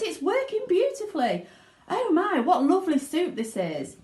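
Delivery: put-on high, delighted girl's voice; Violet. Violet boasts to everyone while chewing, delighted. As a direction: savour every word, showing off. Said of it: "said Violet".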